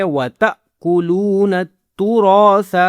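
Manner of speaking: وَتَأْكُلُونَ with a sudden stop after "ta"; jerked hamza. Shown as a demonstration. Teacher showing the rule just explained, jerked "ta" in وَتَأْكُلُونَ. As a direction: snap off "ta" in وَتَأْكُلُونَ, stretch the later vowels. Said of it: "جھٹکے والی آواز نکالتے ہیں".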